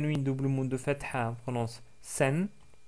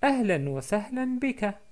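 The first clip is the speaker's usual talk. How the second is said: Ahlan wa sahlan bika, voiced higher as Sarah; teacher voicing the girl Sarah's greeting, higher; higher girl's reply; 9 semitones higher, 4 dB louder than usual. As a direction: play the girl answering, lifted and brighter. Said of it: "répond en disant".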